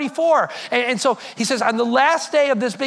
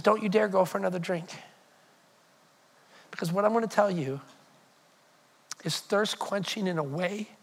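soft, low and deliberate; hushed, intent promise; 4 semitones lower, 8 dB quieter than usual. Acted. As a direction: drop to a low, confiding hush. Jesus confides amid the party.

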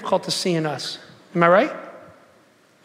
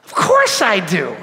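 mock indignant; much slower than usual. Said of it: "And I'm like".

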